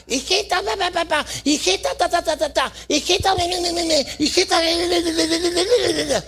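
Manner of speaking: high-pitched mock nagging mother chatter. Mocking a mother who nags her daughter all day in a high babble. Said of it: "la mamá puede decir todo el día".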